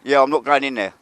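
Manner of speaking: loud, high call across the yard. Real, not acted. Vlogger hails the railway worker across the yard, calling out loudly. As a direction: hail him from a distance, cheerful and loud.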